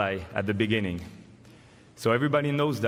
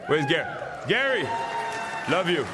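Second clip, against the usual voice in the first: calling out; 5 semitones higher than usual. Real, not acted. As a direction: yell to a friend in the crowd. Calling out to Gary in crowd.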